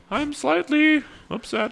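very high, slow put-on voice; hammed-up strained upset; imitated restrained anger. Mocking Jordan Peterson getting angry, high and strained. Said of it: "I can't imagine he gets angry".

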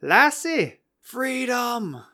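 high-pitched mock-Scottish cry; hammed-up Scottish exclamations, each falling off; Scottish accent. Shown as a demonstration. Podcaster still attempting the accent, high exaggerated Scottish voice. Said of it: "say it in a Scottish accent".

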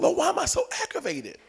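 high, soft plea to God; pleading question. Retold or imitated. Driver praying in the car, high soft plea. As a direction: whine it softly upward in exasperation.

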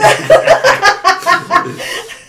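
loud laughing fit. Real, not acted. Brother's skull emojis cracks her up.

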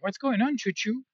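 a light mock-sweet tease of the sibling; teasing pet name. Monk teasing his brother with a pet name, playful and high. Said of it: "And he's like".

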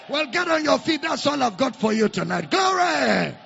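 loud rousing call to stand; rousing shout. Real, not acted. Pastor rallying the congregation to its feet, shouting. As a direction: lift the room with a shout. Preaching at full force.

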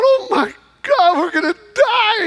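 high, frightened cry of terror; panicked cry. Retold or imitated. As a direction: cry out as if doom is coming. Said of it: "And he cries out".

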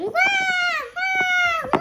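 put-on high wailing baby cry. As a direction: wail like a tiny baby. Monster baby crying in game.

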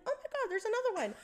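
thrilled rush at each new quarter; finding quarters on the deck, excited; excited discovery. Retold or imitated.

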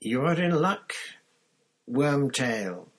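high, drawn-out cold villain; cold, high-pitched voice. A narrator's reading. Cold master addresses Wormtail from the depths of the chair, high-pitched. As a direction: speak high and cold, savouring each phrase. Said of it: "said a cold, high-pitched voice".

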